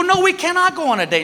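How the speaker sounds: high, mock-firm refusal; stubborn refusal. Husband refusing his wife's date, mock firm. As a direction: dig in and refuse. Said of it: "And I go".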